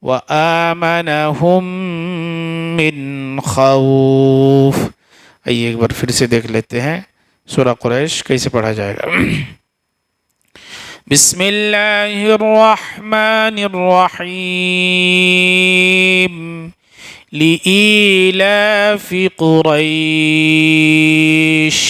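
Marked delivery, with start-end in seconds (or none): 0.0-4.8 slow melodic chant with long held notes; tajweed recitation. Acted. Teacher showing students the right way in melodic recitation. 11.1-21.8 slow melodic chant with long held notes; tajweed recitation. Acted. Teacher showing students the right way in melodic recitation.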